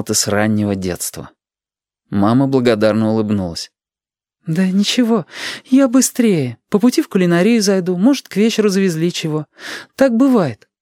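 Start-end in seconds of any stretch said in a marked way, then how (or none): 4.5-10.7 high-pitched mother's voice; high, reassuring mum; busy mother. A narrator's reading. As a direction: voice a mum brushing off concern, pitched well up.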